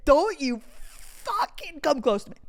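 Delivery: emphatic mock-angry warning; play mad. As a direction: warn him off, pretending to be furious. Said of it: "like, play mad".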